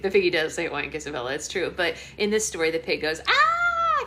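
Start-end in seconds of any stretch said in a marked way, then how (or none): 3.3-4.0 very high loud mock shriek; scared pig squeal. Mother explains what the pig says in this story, mock-scared squeal. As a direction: squeal in pretend terror. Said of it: "'cause he's so scared".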